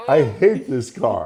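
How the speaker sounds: mock disgust. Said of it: "I go".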